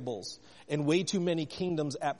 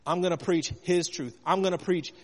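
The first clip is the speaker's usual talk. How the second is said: rising, louder preaching; 4 semitones higher, 5 dB louder than usual. Acted. Pastor preaching louder and higher to the congregation. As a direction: drive it home to the church with conviction.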